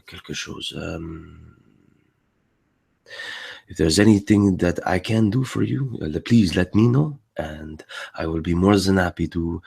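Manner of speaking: noble French accent, courteous; polite upper-class Frenchman speaking halting English; high French accent. Shown as a demonstration. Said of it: "in a more high French accent".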